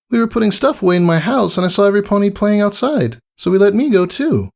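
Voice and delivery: high, shy little filly; small admiring voice; lightly voiced filly. Scootaloo answering the mare, shy filly voice.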